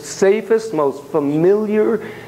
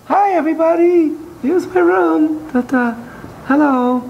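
doll voice greeting; 11 semitones higher than usual. Pretend. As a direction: make the toy wave hello to the room. Voicing dolls in dollhouse.

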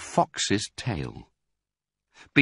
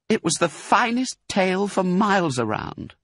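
much higher, gentle wife voice; tender Missus Fox; 11 semitones higher than usual. A narrator's reading. Wife consoling husband between licks, tender and high. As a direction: voice a doting vixen, pitched high. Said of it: "she said, between licks".

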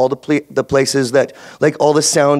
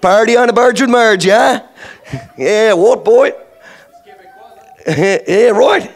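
higher, louder hammed-up East Coast Canadian accent; East Coaster accent; 8 semitones higher, 4 dB louder than usual. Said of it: "she got East Coaster now, eh?".

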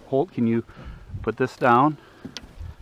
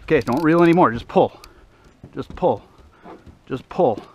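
fighting a fish; 2 semitones higher, 5 dB louder than usual. Real, not acted. Urgent coaching during fish fight.

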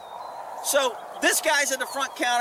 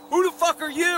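mock angry dad; 8 semitones higher, 4 dB louder than usual. Retelling the angry dad's challenge.

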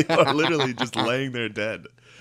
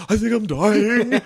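much higher, louder and slow, a wailed hammed-up death cry; mock dying vampire; 11 semitones higher, 7 dB louder, slower than usual. Laughing friend voicing a dying trench-coat vampire, high slow wail. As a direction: wail it like a melodramatic goner.

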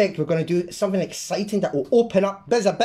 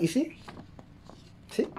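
throat giving out, hoarse; strained hoarse voice; 7 semitones higher, 10 dB quieter than usual. Real, not acted. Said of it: "My throat, my throat's going".

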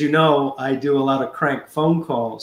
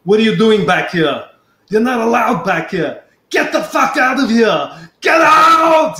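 building from stern to a full shout; furious Arnold throwing him out; 8 semitones higher, 4 dB louder than usual. Retold or imitated. Bodybuilding star throws fan out backstage, building to shout. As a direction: start hard and climb to a yelled get out. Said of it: "He goes".

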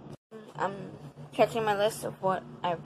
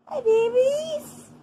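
very high and slow cooing greeting; 7 dB louder, much slower than usual. Real, not acted. Girl greeting her bathed dogs, high slow coo.

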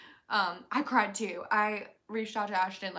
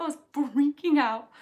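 loud; 4 semitones higher, 11 dB louder than usual. Real, not acted. Repeated loud.

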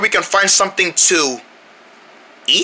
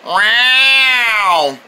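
long drawn-out eerie cat yowl; creepy meow. Acted. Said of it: "That was a creepy meow".

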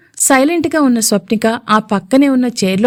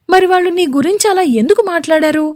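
higher, suspicious question; doubtful; 7 semitones higher than usual. A narrator's reading. Swapnika questions her husband, higher and doubtful. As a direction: probe with doubt in the voice. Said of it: "డౌట్ఫుల్గా చూస్తూ".

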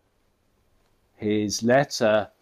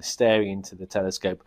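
ordinary delivery

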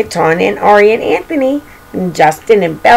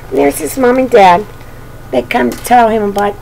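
ordinary delivery

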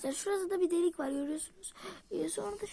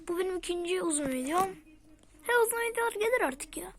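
normal talking voice

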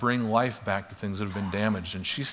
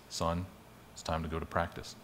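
gentle father's voice; 6 semitones lower, 10 dB quieter than usual. Retold or imitated. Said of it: "He says things like this".

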